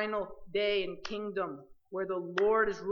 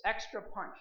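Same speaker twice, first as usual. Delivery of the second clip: ordinary delivery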